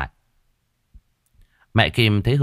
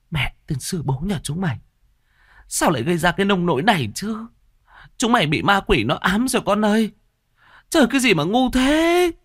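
high, frantic mother's scolding; a mother wailing at her foolish kids; panicked scolding mother; 12 semitones higher than usual. A narrator's reading. (người mẹ hốt hoảng mắng con)

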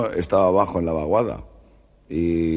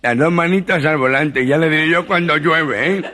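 comic nasal scolding, higher; gangoso voice; 9 semitones higher, 3 dB louder than usual. Retold or imitated. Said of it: "Y hace el taxista".

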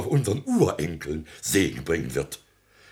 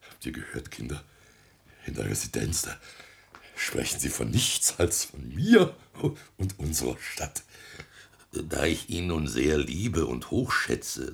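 normal talking voice

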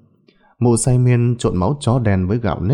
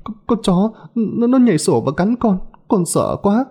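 high-pitched, broken, panicky excuse; tearful, frightened stammer; 8 semitones higher than usual. A narrator's reading. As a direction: stammer through tears, terrified, pitched high. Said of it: "nước mắt rơi lã chã".

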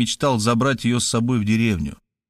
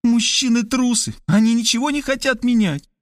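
a higher, lighter woman's voice; Kristina's voice; 12 semitones higher than usual. Acted. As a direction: play the sister, pitched up and urgent.

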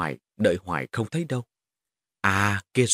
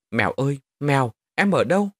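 high, anxious searching call; 4 semitones higher, 4 dB louder than usual. Acted. Boy searching for the vanished girl, high urgent calling. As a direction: call out for someone lost.

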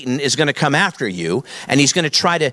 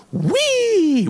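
very high, playful acted whee; gleeful squeal. Preacher on living with God as a thrill ride, mock gleeful squeal. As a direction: squeal way up high, like riding a coaster. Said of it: "there's this sense of".

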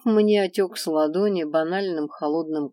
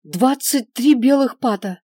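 high, loud blurted price; 6 semitones higher, 9 dB louder than usual. A narrator's reading. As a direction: blurt it out eagerly. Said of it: "выпалил торговец".

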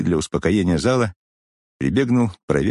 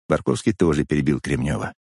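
plain reading voice

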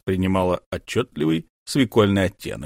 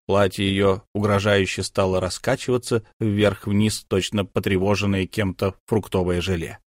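ordinary delivery, nothing marked

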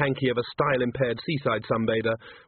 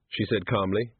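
usual speaking voice

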